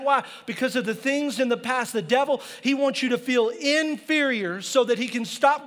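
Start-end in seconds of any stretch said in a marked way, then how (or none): none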